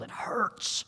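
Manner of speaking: breathy hushed whisper; hushed whisper on the hurt; whispered pain. Acted. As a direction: whisper it like a confession. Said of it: "whispered emphasis".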